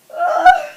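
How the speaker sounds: high, loud mock "ugh"; big groan, retelling the birth. Woman right after delivery, loud groan.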